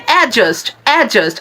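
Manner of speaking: অ্যাডজাস্ট with no d, "A-just"; falling "A-just" for অ্যাডজাস্ট; correct pronunciation. Shown as a demonstration. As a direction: model অ্যাডজাস্ট with silent d. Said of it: "আপনারা উচ্চারণ করবেন".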